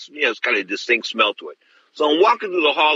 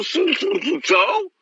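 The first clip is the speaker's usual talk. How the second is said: much higher, flustered repeated so; flustered stammer; slower than usual. Retold or imitated. Stammering nervously when the promoter offers cocaine. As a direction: stall nervously, caught off guard.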